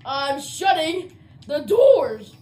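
loud, half-crying threat; angry revenge. Real, not acted. Ejected gamer threatening teammates, loud and tearful. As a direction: spit the revenge at them.

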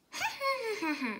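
high cackling witch laugh; witch cackle. A narrator's reading. Middle sister showing off her witch cackle. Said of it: "She cackled".

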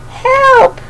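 a frightened cub calling from the ice; storybook cry for help; little cry for help. Acted. Monty drifting out to sea.

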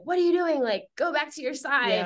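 high, incredulous scolding; mock indignant. Teammates scolding whoever switched warm-up spots, high and incredulous. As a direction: play the outraged teammate. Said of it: "we're like".

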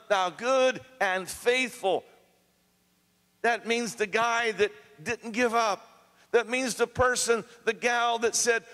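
high, impassioned exhortation to the congregation; preaching at full force. Acted. Preacher urging a weary congregation not to quit, impassioned. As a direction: preach it out, rising and urgent.

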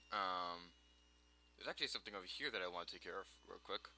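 ordinary delivery

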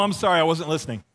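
ordinary delivery

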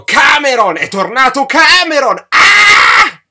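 high, full-force excited scream; hammed-up shriek ending in a scream; screaming in the ear. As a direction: yell the name at the top of your lungs. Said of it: "mi gridò all'orecchio".

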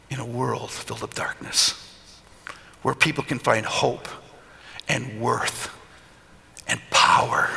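soft, hushed, almost whispered voice of God; hushed divine whisper. Retold or imitated. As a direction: breathe it like a holy whisper. God speaking to him.

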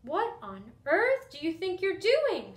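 high, loud scolding yell; a stunned mother's yell; mom yelling. Acted. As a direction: shout it at your son in shock. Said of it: "she yelled".

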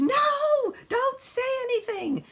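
very high, loud mock scream; retold scream. Plane passengers silencing a spoiler, high loud mock scream. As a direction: shriek it like a whole plane panicking. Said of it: "the rest of the plane screamed".

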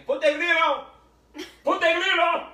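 put-on loud, high Latin-accent shout at the grill; hammed-up Latin accent, calling out; shouting in a thick Latin accent. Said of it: "he's got this great Latin accent".

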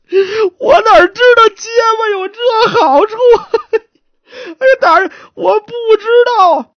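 high, loud mock sobbing; wailing protest. Suspect blaming the stutterer's advantage, loudly wailing. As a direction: wail helplessly through the words. Beaten suspect wails to magistrate.

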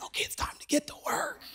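whispered call to action; conspiratorial cue. Retold or imitated. Wife's understood cue to start ministering.

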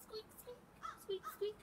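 tiny high-pitched squeaks; lantern squeak. A narrator's reading. Reader mimics lantern swinging in the dark, squeaky. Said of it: "went the lantern".

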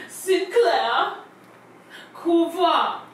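"Sinclair" and "Couva" drawn out with a pause between; slow, taught name. Shown as a demonstration. Caller teaches the psychic her name, slow "Sinclair ... Couva". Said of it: "Yes, say it with me".

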